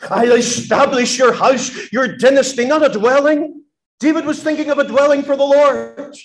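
high, ringing preacher's cry; preaching. Acted. Preacher proclaiming God's promise to David, high and loud. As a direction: lift the promise up to the rafters.

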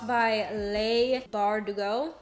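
slow, halting, rising at the end; drawn-out, tentative reading of a name; careful sounding-out. Real, not acted. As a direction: feel your way through the author's name slowly. Sounds out author's name, then repeats.

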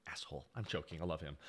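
very soft, low aside; muttered aside. Real, not acted. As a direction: drop it right down under your breath. Muttered aside about colleague.

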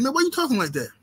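high, disbelieving friend asking; incredulous homeboy. Retold or imitated. Homeboy teasing him about his voice, high and incredulous. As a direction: needle him like a buddy who can't believe it.

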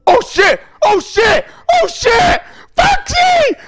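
mounting panic. Real, not acted. Enemy appears, rising panic.

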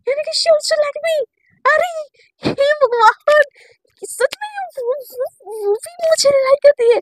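very high, loud, squealing delight; giddy disbelief. Retold or imitated. Girl realising her crush likes her back, squealing. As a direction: squeal with sudden joy.

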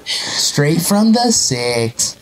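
very slow, stretched-out narrator voice; imitating Chills's narration. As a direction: drag out every word in mock narration. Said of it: "nobody in Canada talks".

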